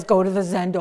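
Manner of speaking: loud prim command; mocking order. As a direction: send him off like a stickler. Mocking rigid monk.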